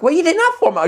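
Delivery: high retold motherly alarm; mock exasperated scolding. Mum asks son about his gesture, mock exasperation.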